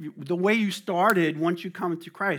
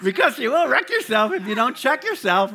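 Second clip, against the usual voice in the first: louder and higher, driving it home; emphatic preaching; 7 semitones higher, 10 dB louder than usual. Real, not acted. Preacher warns the congregation, loud and emphatic. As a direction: hammer the warning.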